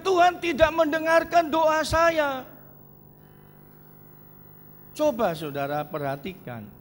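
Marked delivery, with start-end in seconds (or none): 0.0-2.4 complaining. Retold or imitated. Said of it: "Orang Kristen bilang".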